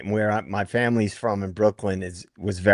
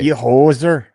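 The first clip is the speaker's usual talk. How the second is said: louder mock Canadian hoser insult; Bob and Doug McKenzie; 2 semitones higher, 7 dB louder than usual. Panelist joining in the comedy duo bit, loud mock hoser. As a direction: toss it out as a comic Canadian put-down. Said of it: "what about Bob and Doug McKenzie".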